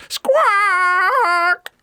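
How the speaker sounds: one long, piercing squawk; very high, loud bird cry; parrot squawk. Acted. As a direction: screech like a noisy parrot. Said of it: "all they heard was".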